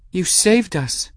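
put-on high, slow, overwhelmed young woman; grateful girl. A narrator's reading. Girl hugs the cat who saved her, overwhelmed. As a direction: say it high, close to tears with gratitude.

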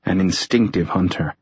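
ordinary delivery, nothing marked

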